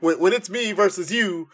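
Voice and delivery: high, loud trash-talk promo; wrestling promo. Pretend. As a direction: cut a promo like Scott Steiner. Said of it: "cut a promo doing the Steiner maths".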